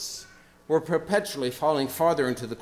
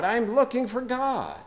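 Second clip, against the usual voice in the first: higher, more desperate repeat of the cry; cried out; 12 semitones higher, 4 dB louder than usual. A narrator's reading. Preacher reading Nietzsche's parable, the madman's cry rising higher. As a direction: climb even higher on the second cry. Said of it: "cried incessantly".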